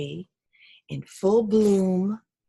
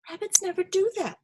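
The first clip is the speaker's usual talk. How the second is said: higher, small rabbit voice; sleepy little rabbit; 11 semitones higher, faster than usual. Acted. Sleepy rabbit answers the owl, small high voice. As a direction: answer back like a sleepy bunny. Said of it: "said the sleepy little rabbit".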